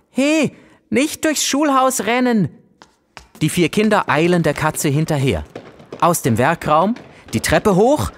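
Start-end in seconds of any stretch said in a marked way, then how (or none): 0.1-2.5 high, slow call after the cat. A narrator's reading. Ava calling after the fleeing cat, high call. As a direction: call out a mock teacher warning. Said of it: "Ava ruft ihr nach".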